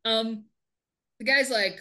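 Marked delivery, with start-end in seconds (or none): none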